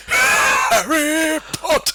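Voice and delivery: high, slow dramatic shout; shouted name. Retold or imitated. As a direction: belt the name out. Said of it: "be able to go".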